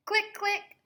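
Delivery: high chirpy camera clicks; playful high clicking noise; click click sound. Pretend.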